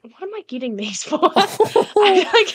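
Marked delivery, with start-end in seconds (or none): 1.2-2.5 laughing high through the words. Real, not acted. Player laughing at forgetting what she was doing, high and giggly. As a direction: giggle while admitting you lost track.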